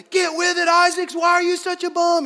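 high, imitated yelling coach; mock coach yell. Coach berating a kid at sports, mock yell. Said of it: "coaches would, you know, be yelling".

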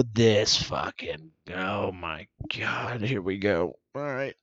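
low, soft, slow under-breath grumble; exasperated mutter. Retold or imitated. Shopper muttering on seeing a maskless mom. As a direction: grumble to yourself, fed up. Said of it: "and go".

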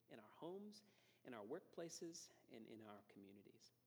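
soft, low pastoral prayer voice; hushed, earnest church prayer; closing prayer. Acted. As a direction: pray it quietly over the congregation.

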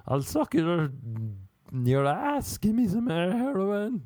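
high, hammed-up addict begging; mock junkie wheedling; imagined heroin user. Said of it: "That's what I imagine heroin users say".

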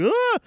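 high startled cry; startled yelp. Retold or imitated. Prisoner yelps on recognizing Malcolm, high and sudden. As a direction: yelp in shock at the reveal. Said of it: "He's like".

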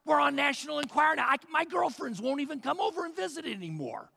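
put-on embarrassed kid griping at Dad; mock whiny teen; whiny teenager. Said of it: "Imagine the teenagers".

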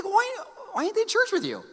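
high, incredulous retold question; can't believe the kid stays home. Pastor asking the parent about the absent teen, high and incredulous. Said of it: "I'm like".

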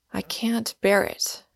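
plain reading voice

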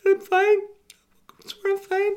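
very high squeaky insisting; strained high denial; insisting I'm fine. Pretend. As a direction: squeak it, trying too hard to sound okay. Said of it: "but I'm like".

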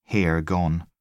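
ordinary delivery

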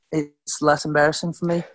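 put-on British accent, slightly Aussie; careful imitation of a British accent. Shown as a demonstration. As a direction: demonstrate your best British accent. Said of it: "I do an okay British accent".

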